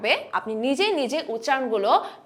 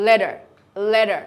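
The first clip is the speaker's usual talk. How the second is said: American flap T; 5 semitones lower, 3 dB louder, much slower than usual. Shown as a demonstration. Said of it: "this is".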